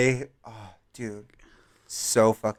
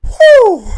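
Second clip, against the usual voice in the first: sharp mock exhale; 13 dB louder than usual. Exhale after snorting.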